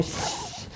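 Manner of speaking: soft unvoiced shush; hushing shh. Teacher hushing retreat chatter, a soft shush. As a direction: hush the room. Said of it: "I'm always, like, going".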